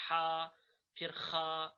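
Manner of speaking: "ہا" and "خا" held on long aa; Arabic letter names. Shown as a demonstration. Teacher follows letters on screen, drawn-out Arabic pronunciation. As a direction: say "ہا، خا" deliberately, level and drawn out. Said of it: "ان کے ساتھ ہم الف ملا دیتے".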